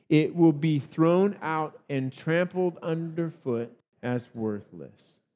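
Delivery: slow, measured recitation read aloud together; solemn, deliberate verse reading; Bible verse reading voice. Real, not acted. Pastor leads church reading Matthew together, slow solemn recitation. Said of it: "in your best New Life Bible verse reading voice".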